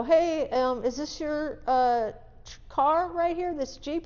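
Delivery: high, sing-song innocent question; playing dumb to bait him; feigned innocence. Confronting the driver innocently.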